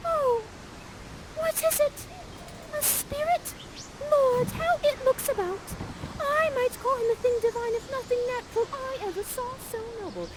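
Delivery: put-on high, breathless young maiden in awe; high girlish Miranda. Miranda peeking at Ferdinand, in a high awed voice. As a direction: gush it out like a smitten girl. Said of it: "and says".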